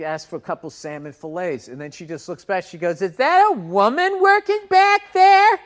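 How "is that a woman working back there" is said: high, loud, slow mock gushing. Older lady at fish counter gushing, high and loud. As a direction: gush so the whole store hears. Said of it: "all loud so everybody could hear".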